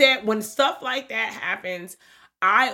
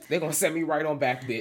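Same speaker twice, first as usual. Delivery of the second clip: ordinary talk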